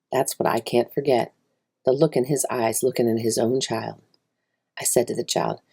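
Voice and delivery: ordinary delivery, nothing marked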